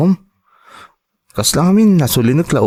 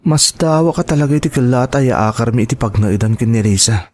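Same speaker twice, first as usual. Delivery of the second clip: usual speaking voice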